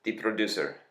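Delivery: lightly imitated Russian accent; hint of a Russian accent. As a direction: announce it with a touch of Russian accent. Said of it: "thick Russian accent".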